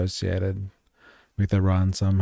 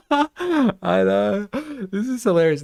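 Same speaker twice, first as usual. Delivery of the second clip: laughing; 10 semitones higher, 3 dB louder than usual. Real, not acted. Laughing at absurd clip.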